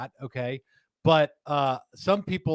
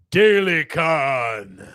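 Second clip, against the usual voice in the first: announcer hype; 8 dB louder, much slower than usual. Acted. Hyping the event name.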